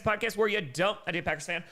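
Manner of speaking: ordinary delivery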